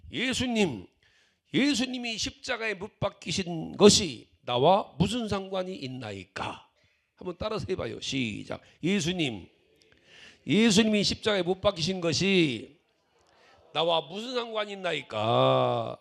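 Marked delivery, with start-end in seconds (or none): 0.1-6.7 slow, loud model for others to repeat; loud cry. Shown as a demonstration. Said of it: "큰 소리로 외치고". 8.8-16.0 slow, loud model for others to repeat; loud cry. Shown as a demonstration. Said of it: "큰 소리로 외치고".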